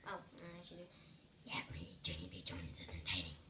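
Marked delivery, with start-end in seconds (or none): 1.4-3.4 low spooky whisper; scary voice. Acted. Junie B hiding under the desk, fooling the grown-ups, spooky whisper. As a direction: whisper it low to sound creepy. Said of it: "in a scary voice".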